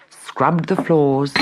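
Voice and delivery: plain reading voice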